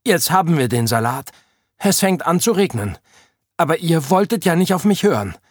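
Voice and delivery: high-pitched, whiny I-told-you-so; reproachful complaint. A narrator's reading. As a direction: scold them, speeding up at the end. Fred complains nobody listened to him. (vorwurfsvoll jammern, hohe Stimme)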